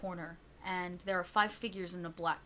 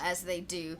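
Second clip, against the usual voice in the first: usual speaking voice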